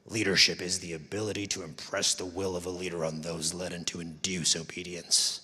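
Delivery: low, sinister authoritarian reading; Anakin Skywalker. Retold or imitated. Quoting a definition of leadership at a conference, low and villainous. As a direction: intone it as a dark villain's decree. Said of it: "that person was Anakin Skywalker".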